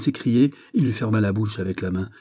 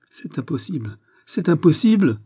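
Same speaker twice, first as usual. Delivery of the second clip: disbelieving cry; 8 semitones higher, 7 dB louder than usual. A narrator's reading. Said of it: "s'écria-t-elle". (cri de protestation, de plus en plus fort)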